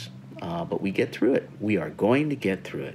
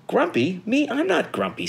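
high-pitched protest; indignant denial; 12 semitones higher than usual. A narrator's reading. Jim denying grumpiness to Norman and Snake, indignant. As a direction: deny it high and affronted.

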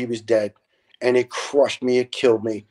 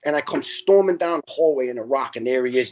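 ordinary talk, nothing marked

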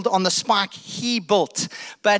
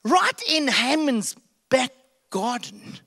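sly servant; 10 semitones higher than usual. Acted. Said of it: "One of the guys go".

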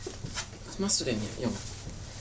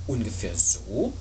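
lightly voiced motherly bear, low and inviting; mother bear character; 12 semitones lower than usual. Mother bear offers a kiss.

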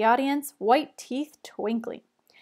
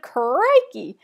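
loud falling exclamation; shouted; 7 dB louder than usual. A narrator's reading. Lou shouting his catchphrase at the kids, loud. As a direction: punch it out as a catchphrase. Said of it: "He shouted".

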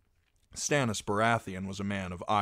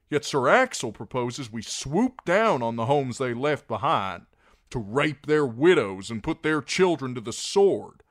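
raised, high, fervent plea; Davos, impassioned; 8 semitones higher, 9 dB louder than usual. A narrator's reading. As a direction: argue for them, building loud then easing.

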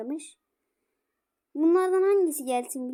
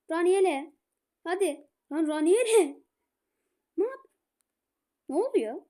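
ordinary delivery

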